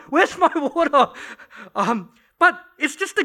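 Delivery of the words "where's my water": calling out. Retold or imitated. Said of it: "I just heard her call".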